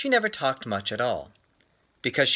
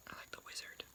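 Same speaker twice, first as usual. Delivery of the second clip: soft whispered aside; 24 dB quieter, much faster than usual. Acted. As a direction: whisper it like a shared secret.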